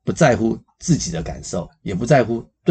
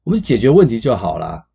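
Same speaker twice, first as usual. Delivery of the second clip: higher, louder brushing off her feelings; a boyfriend waving off her emotions, impatient and louder; 5 semitones higher, 6 dB louder than usual. Retold or imitated. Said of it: "很多男生就会说".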